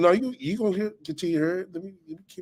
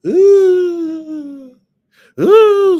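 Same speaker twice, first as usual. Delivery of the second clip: high, loud, drawn-out "ooh" like a ghost; imitated spooky ghost howl; ghostly wail; 12 dB louder than usual. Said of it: "sound like a motherfucking ghost".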